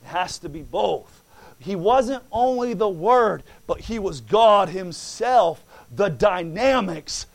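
loud, rising preacher's cadence, punching key words; full-force preaching. Acted. Preacher exhorting the church about the bride, full force. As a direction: preach it to the congregation.

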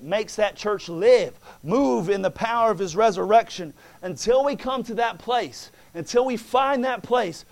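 loud, rising preacher's cadence, punching key words; full-force preaching. Acted. Preacher exhorting the church about the bride, full force. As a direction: preach it to the congregation.

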